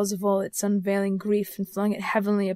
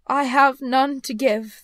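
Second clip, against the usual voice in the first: higher, louder, slow spectral voice; sorrowful ghost; 4 semitones higher, 8 dB louder, slower than usual. Acted. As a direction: intone it slowly like a mournful phantom.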